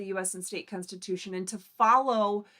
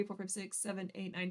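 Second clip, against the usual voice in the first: ordinary delivery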